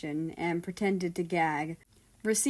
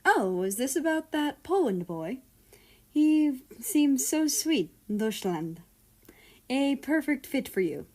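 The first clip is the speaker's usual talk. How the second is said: higher, gushing parent voice; 6 semitones higher, 3 dB louder than usual. A narrator's reading. Doting father teasing his son about Poland, higher and cooing. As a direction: coo it like a doting mother. Parent gushes over son's crush.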